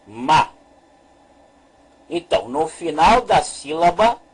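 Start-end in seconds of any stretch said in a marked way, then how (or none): 0.1-0.5 "ma" read as one open syllable ending in A. Shown as a demonstration. Teacher reads the second syllable aloud, "ma" set apart clearly. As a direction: sound out "ma" on its own. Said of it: "aqui nós temos a segunda sílaba".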